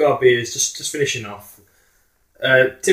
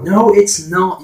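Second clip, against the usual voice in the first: firm refusal; 8 semitones higher, 6 dB louder, much slower than usual. Retold or imitated. Refusing the offer, repeated.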